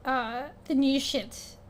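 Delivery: ordinary talk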